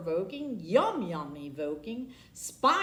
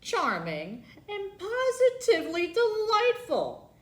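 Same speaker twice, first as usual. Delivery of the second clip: boastful brag; 6 semitones higher, 5 dB louder than usual. Acted. Beth Ann boasting about herself.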